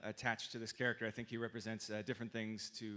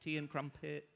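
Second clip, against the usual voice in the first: playful British accent, lightly put on; mock British accent; 3 semitones higher than usual. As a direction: toss it off like a teasing Brit. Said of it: "you could do a British accent".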